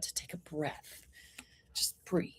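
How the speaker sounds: soft, breathy whisper; hushed, letting the breath out; whispered calm. Real, not acted. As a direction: whisper it as a soothing reminder. Soothing advice to take a breath.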